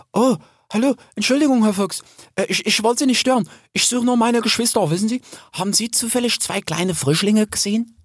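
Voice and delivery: high, polite, apologetic character voice; shy young sow. A narrator's reading. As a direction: ask timidly, sorry to disturb. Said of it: "sah sie schüchtern". (schüchterne, hohe Bachenstimme)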